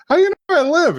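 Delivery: high-pitched startled mimicry; alarmed question. Acting out a character's alarmed question.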